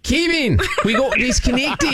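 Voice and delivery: high, hammed-up Aussie accent; shout-free but showy fake Aussie accent; Australian accent. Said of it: "with a slight Australian accent".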